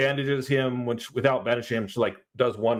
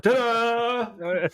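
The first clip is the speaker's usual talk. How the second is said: high showy ta-da; mock ta-da; 10 semitones higher than usual. Cohost mocking the lazy eagle rescue, a high showy ta-da. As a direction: present it like a cheap magic trick. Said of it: "mock magic reveal".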